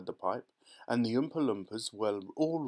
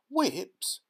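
much higher, louder single word; appalled at the sign; shocked cry; 9 semitones higher, 4 dB louder than usual. A narrator's reading. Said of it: "cried Veruca Salt".

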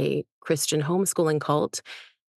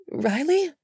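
tentative call; 9 semitones higher than usual. A narrator's reading. Calling to sick friend.

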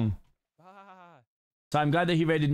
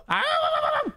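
long, high-pitched mock howl; high wavering howl. Guarding the sheep, playful high howl. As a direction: howl up high and let it wobble.

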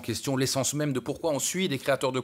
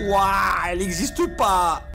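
slow, loud, stunned outburst; outraged disbelief; 7 semitones higher, 7 dB louder, slower than usual. Real, not acted. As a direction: shout it in disbelief. Outraged at fake influencer's fee.

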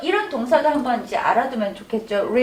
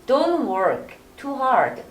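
slow model read; much slower than usual. Shown as a demonstration. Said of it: "너무 열심히 일하지 마".

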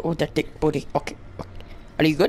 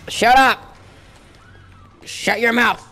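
shouted telling-off; 6 semitones higher, 4 dB louder than usual. Pretend. Player silencing a screaming game character, high shout. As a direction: shout them down.

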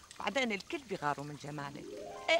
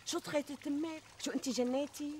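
high, startled, incredulous; can't believe what she just heard; shocked disbelief; 7 semitones higher, 3 dB quieter than usual. Acted. Friend hears sewing mouth shut.

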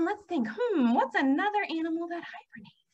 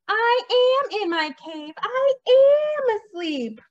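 high, slow, exasperated bear complaint; grumpy storybook bear, heavily drawn out; grumbled; 9 semitones higher, 8 dB louder, much slower than usual. A narrator's reading. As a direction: stretch the words in loud protest. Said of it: "grumbled Bear".

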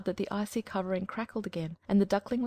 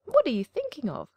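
high, louder indignant hen cry; 6 semitones higher, 5 dB louder than usual. Acted. Hen crying out at the duckling's foolish longing. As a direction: squawk it out in disbelief. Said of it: "cried the hen".

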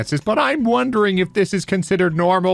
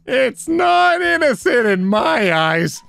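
mock indignant complainer; 6 semitones higher, slower than usual. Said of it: "Mister Shan was unhappy".

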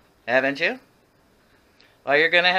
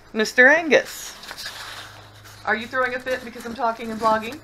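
pet talk; 4 semitones higher than usual. Real, not acted. Scolding the fussing dog.

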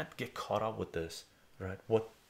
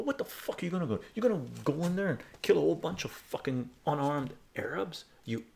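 retold furious rant; 7 semitones higher than usual. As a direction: tear into them all over again. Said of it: "I go".

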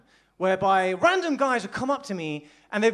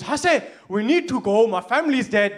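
fake urgency; 6 dB louder than usual. Amir fakes emergency.

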